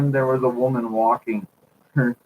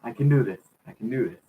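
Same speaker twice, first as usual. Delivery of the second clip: ordinary delivery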